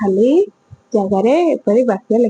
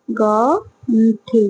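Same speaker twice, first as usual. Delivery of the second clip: ଗଣ୍ଠି stretched out very slowly, syllable by syllable; slow, drawn-out; much slower than usual. Shown as a demonstration. Teacher models ଗଣ୍ଠି slowly for the class, drawn out. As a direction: draw out ଗଣ୍ଠି slowly so children can copy. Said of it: "ହେଲା".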